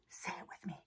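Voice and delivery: unvoiced whispered cue. Teacher whispering to the class in the cave. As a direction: breathe it so the bear won't hear.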